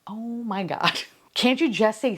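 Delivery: ordinary talk, nothing marked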